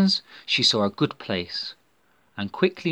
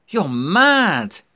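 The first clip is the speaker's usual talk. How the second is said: high, loud frightened shout; 9 semitones higher, 8 dB louder than usual. A narrator's reading. Scared girl refusing her friend, shouting. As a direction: yell the refusal in panic. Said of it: "shouted Natalia".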